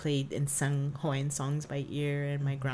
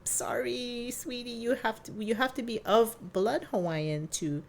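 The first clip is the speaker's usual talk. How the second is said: gentle mom; 8 semitones higher than usual. Retold or imitated. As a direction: break it gently to a child. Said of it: "my mom's like".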